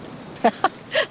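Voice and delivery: short airy chuckle; a quick amused breath of a laugh; light laugh. Acted. Amused at Haggerty's job, a light airy chuckle.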